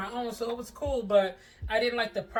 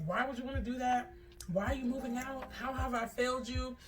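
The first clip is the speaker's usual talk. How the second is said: higher pleading mom voice; upset mom; 5 semitones higher than usual. Retold or imitated. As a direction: plead like a hurt mother.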